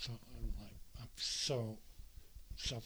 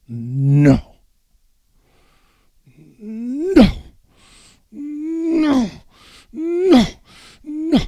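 repeated no, louder and higher each time; shouting no; 13 semitones higher, 9 dB louder, much slower than usual. Real, not acted. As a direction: hit the book and yell it out. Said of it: "just say no".

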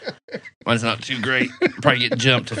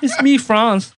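higher, laughing mock German voice; 12 semitones higher, 4 dB louder, much slower than usual. Co-host playing a German listener named Franz, laughing mock German. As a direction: introduce yourself as a jolly German. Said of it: "I got my own little German going on".

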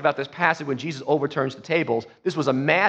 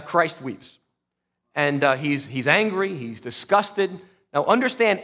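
normal talking voice

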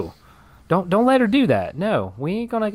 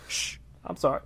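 much softer, whispered sorry; quick whispered aside; hushed apology; 4 semitones lower, 11 dB quieter than usual. Acted. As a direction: shush yourself and whisper it. Kaylee hushes herself.

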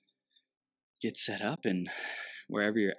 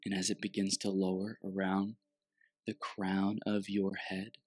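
guided meditation. Acted.

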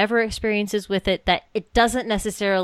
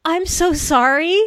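high, louder, slow stunned sympathy; caught-off-guard sympathy; 10 semitones higher, 5 dB louder, much slower than usual. Retold or imitated. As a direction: fumble out a shocked sorry. Retold awkward condolence.

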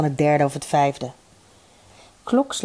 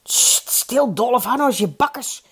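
higher-pitched hushing rebuke; shushing; 6 semitones higher than usual. A narrator's reading. All the tables silence Dolf, shushing in raised pitch. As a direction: shush the heckler with a long hiss.

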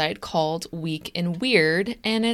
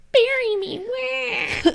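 put-on ghost baby voice; slower than usual. Ghost baby demanding burial.

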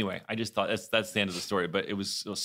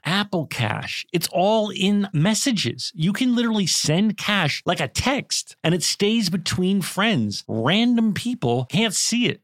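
high, slow, emphatic pitch; ad read; 12 semitones higher, 9 dB louder, slower than usual. Acted. Friend recommending a payment service in an ad, upbeat pitch. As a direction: sell it to a friend word by word. Acted ad skit.

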